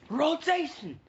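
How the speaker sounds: high, loud battle cry; attack call. Retold or imitated. Neji launching his Rotation, battle shout. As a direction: shout the move name. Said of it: "Uh, he says".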